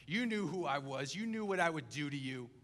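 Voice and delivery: loud furious accusation; infuriated. Acted. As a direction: hurl it at the servant in rage. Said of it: "the master is infuriated".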